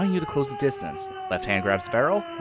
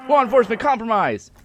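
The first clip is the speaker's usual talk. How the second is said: high, loud alarmed cop shout; screamed into megaphone; 10 semitones higher, 6 dB louder than usual. A narrator's reading. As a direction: scream it as a police alert. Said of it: "She screams into the megaphone".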